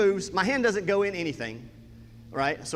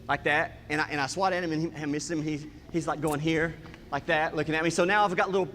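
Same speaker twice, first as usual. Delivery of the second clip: ordinary delivery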